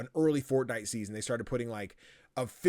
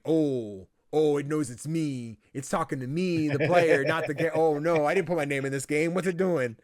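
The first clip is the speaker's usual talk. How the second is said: raised, louder voice of startled realisation; mock alarm; 4 semitones higher, 5 dB louder than usual. Player realising the game knows his name, mock alarm. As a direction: build into hammed-up dismay.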